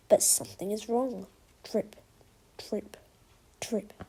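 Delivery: plain reading voice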